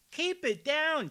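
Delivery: high, loud teacher scolding; mock teacher. Commentator mocking the councilwoman as a shrill teacher. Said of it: "he's not your student in the classroom".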